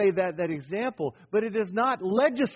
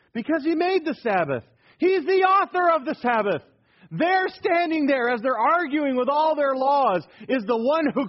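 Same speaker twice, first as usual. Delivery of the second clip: much higher, louder preacher's climax; preaching at full force; 7 semitones higher, 5 dB louder than usual. Acted. Preacher thundering that Jesus authored the Sabbath, full force. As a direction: build it to a ringing peak.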